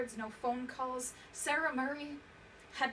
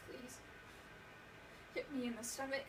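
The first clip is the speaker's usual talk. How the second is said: drained plea; 7 dB quieter than usual. Acted. Same plea repeated, drained and soft.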